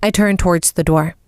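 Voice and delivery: usual speaking voice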